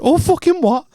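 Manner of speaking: very high-pitched incredulous outburst; retold exasperation. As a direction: re-enact losing patience, pitch shooting up. Retold outburst at being told off.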